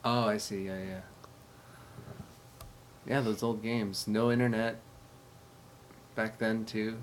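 usual speaking voice

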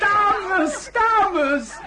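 loud fussy summons over the chatter; calling out. Acted. Ceremony master calls the chattering ladies to order, loud. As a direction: raise the voice to gather the ladies.

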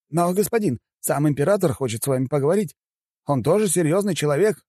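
high-pitched assistant's mild protest; exclaiming. A narrator's reading. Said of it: "воскликнул помощник". (восклицает высоким голосом)

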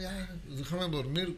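sleepy voice. Pretend. Mock sleepy plea.

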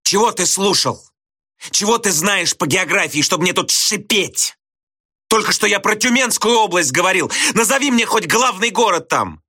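high-pitched, heated grilling; angry challenge. A narrator's reading. Teacher grills a pupil on geography, angrily.

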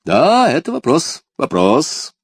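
high, loud amused exclamation; exclaiming with a smile. A narrator's reading. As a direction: cry it out delighted, smiling. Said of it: "воскликнул, улыбаясь, король".

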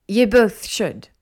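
put-on slow, loud interjection in an Italian accent; thick Italian accent. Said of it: "came a woman's voice, the Italian accent thick".

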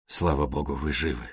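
put-on relieved young man, hoarse and hushed. A narrator's reading. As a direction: rasp it out low with relief. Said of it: "хрипло произнёс Стивен Ферр".

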